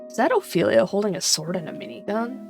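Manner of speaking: plain reading voice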